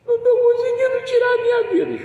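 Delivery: voz aguda e chorosa, imitando a mulher; chorando imitado. Retold or imitated. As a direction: fala chorando, bem mais aguda. (imitando choro, voz aguda e trêmula)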